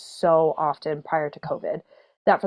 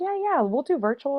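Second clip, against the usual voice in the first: cheerful quote; 8 semitones higher than usual. Retold or imitated. Retelling the school's reply.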